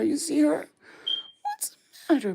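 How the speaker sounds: high cooing to the dog. Real, not acted. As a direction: raise the voice to soothe a pet. Soothing scared dog.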